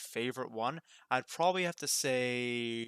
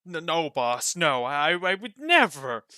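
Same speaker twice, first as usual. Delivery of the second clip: loud, higher, stammered and slowed; stammering denial; 5 semitones higher, 10 dB louder, slower than usual. Acted. Roka nervously denies eating gems to his boss. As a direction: stutter out the lie in panic.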